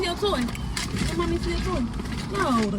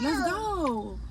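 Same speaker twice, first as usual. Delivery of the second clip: ordinary delivery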